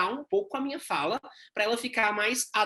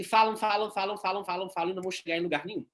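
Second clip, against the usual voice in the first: rapid chatter; faster than usual. Shown as a demonstration. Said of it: "pessoas que são logorreicas".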